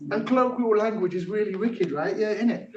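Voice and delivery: lifted, rhythmic rap-style delivery; poem performance. Acted. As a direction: perform it playfully like a rapper. Performs own technique poem.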